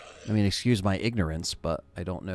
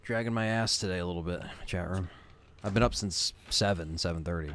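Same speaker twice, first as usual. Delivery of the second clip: tired and yawning; 8 dB quieter than usual. Real, not acted. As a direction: talk like you just woke up. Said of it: "Sorry for the yawning".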